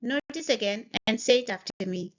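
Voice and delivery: ordinary talk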